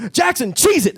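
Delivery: high, loud 1930s gangster yelp; gangster cheese it. Retold or imitated. Calling to his dog Jackson to flee the trooper, a loud gangster yelp. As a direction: yell it like a movie crook fleeing the cops.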